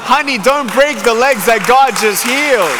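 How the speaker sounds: high, loud, punched-out plea; urgent mock-worried warning; alarmed warning. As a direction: call out in comic alarm, every word hit. Acts out warning the jumping woman.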